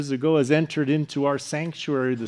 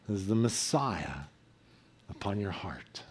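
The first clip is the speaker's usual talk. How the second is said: tender hush; 7 semitones lower, 10 dB quieter than usual. Real, not acted. Tender sermon close.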